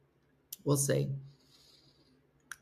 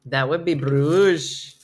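a light imitation of a Flemish accent, slow; viewer's foreign accent; 3 semitones lower, 5 dB louder, much slower than usual. Teasing a Belgian viewer, lightly copying her Flemish accent. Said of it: "I just did that in your accent again".

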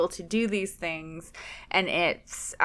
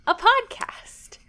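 playful welcome; 5 semitones higher, 4 dB louder than usual. Acted. Hosts' playful show greeting.

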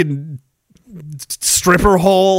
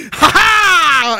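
wild laugh. Retold or imitated. Mitch's prank laugh replayed.

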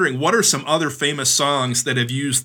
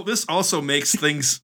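ordinary talk, nothing marked

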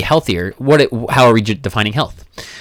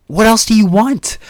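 much higher, slowed, sarcastic question; mock incredulous coach; 12 semitones higher, 3 dB louder than usual. Coach retorting to a client who only wants weight loss, sarcastic and high. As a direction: throw it back at the client in disbelief. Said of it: "A client comes to me".

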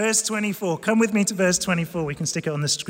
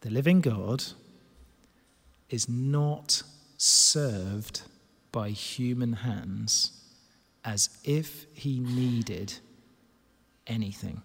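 low, drawn-out phrases with long pauses; slow solemn repetition; 7 semitones lower, much slower than usual. Real, not acted. Preacher repeats the verse so it sinks in, slow and solemn. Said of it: "I'm gonna say it again".

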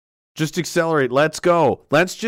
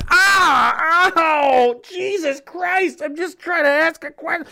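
high-pitched yelp, then wailing complaint; hammed-up cries of a brick victim; mock pained protest; 9 semitones higher, slower than usual. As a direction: play the wounded innocent, pitched way up. Acting questioner hit by thrown bricks.

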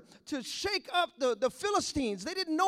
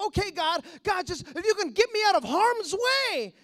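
high, loud begging; urgent mock plea; 4 semitones higher, 6 dB louder than usual. Believer asking God to remove danger, loudly pleading.

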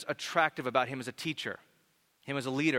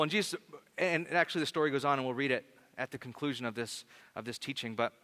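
ordinary talk, nothing marked

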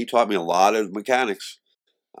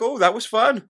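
much higher, a little louder, faux-naive; mock innocent virgin; 11 semitones higher, 4 dB louder than usual. Pretending to be a virgin with girls, mock naive. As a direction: play the wide-eyed beginner. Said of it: "I'd be like".

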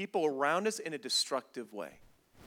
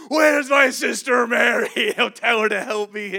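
loud, raised, indignant yell; hammed-up furious shout; Martha charging like a grizzly; 7 semitones higher, 16 dB louder than usual. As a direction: storm in roaring a complaint. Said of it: "coming out of the kitchen like a grizzly bear".

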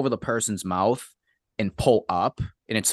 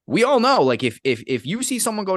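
emphatic; 8 semitones higher than usual. Real, not acted. Emphatic outburst.

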